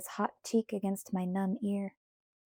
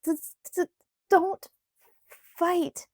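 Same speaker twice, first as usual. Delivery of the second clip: high, stammering plea; shivering plea; 9 semitones higher, 4 dB louder than usual. A narrator's reading. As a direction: beg through chattering teeth. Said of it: "I pleaded".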